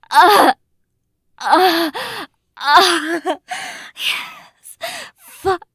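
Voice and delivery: high loud cries of climax; loud climax moans. Acted. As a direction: let the moans peak.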